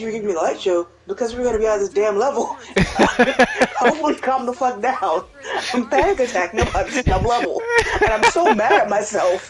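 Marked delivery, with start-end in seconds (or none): 1.1-9.5 high, worked-up voice through laughter; laughing. Real, not acted. Gamer laughs over panicking in a damn level, high and laughing. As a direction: retell your own meltdown, laughing.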